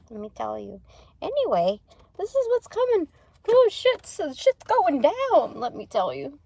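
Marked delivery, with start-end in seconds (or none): 2.2-5.5 high and loud, slowing at the end; wide-eyed excitement; excited. Real, not acted. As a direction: announce it thrilled.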